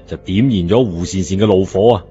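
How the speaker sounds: usual speaking voice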